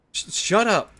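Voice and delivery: normal talking voice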